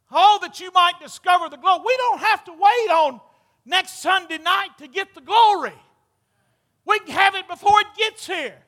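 loud high revival preaching. Acted. Preaching at full force.

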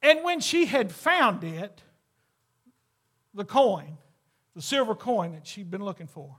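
normal talking voice

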